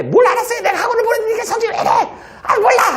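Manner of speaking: very high, fast laughing mock shout; hammed-up mother yelling; furious mom. Teacher voicing mom shouting about academy fees.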